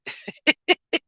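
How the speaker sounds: high, light laugh at his own boast; a quick high giggle; giggling laugh. Real, not acted. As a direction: laugh at yourself. Laughs after trucking royalty joke.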